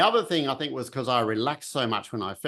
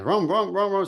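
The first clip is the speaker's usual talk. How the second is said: engine revving; 8 semitones higher, 4 dB louder than usual. Retold or imitated. Imitating a motorbike revving up.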